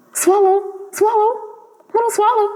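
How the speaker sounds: high, lingering call of the name; a lightly voiced statue prince begging the bird to stay; pleading call. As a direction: call him back gently but urgently. Prince commanding the swallow.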